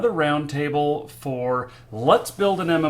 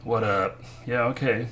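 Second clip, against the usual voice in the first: usual speaking voice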